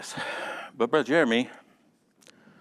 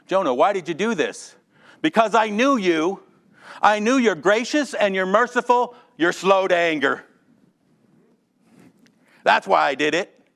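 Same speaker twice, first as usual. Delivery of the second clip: higher, louder, exasperated complaint; mock Jonah; 8 semitones higher, 6 dB louder than usual. Jonah complaining to God about his mercy, exasperated and raised.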